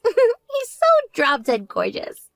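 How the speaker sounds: high, slow giggling gush; giggly swoon. Acted. Younger sister gushing to her sister about the doctor, giggling.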